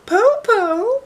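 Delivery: put-on very high, loud little girl call; child calling out. Shang calls down to the wolf, high and bright. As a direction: sing out high like a small girl. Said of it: "Shang called out".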